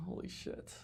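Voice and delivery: breathy whisper under the breath; whispered curse. Real, not acted. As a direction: mutter it stunned. Stunned aside.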